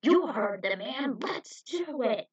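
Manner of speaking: loud, slow, cocky shout; brash rally cry. Acted. Superhuman on the cliff rallies kickers, brash. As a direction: whip up the troops.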